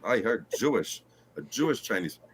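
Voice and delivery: ordinary talk, nothing marked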